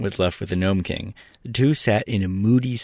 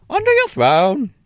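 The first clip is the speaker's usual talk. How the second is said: hen voice; 7 dB louder than usual. A narrator's reading. Billina voiced.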